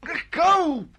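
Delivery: high, strained yelp to let go; pained cry. Acted. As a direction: cry out as someone grips you. Being grabbed in a fight.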